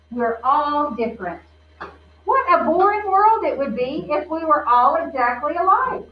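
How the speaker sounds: lightly voiced gentle grandfather; a hint of a wise old grandpa; kind grandpa. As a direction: reassure her like a loving grandpa.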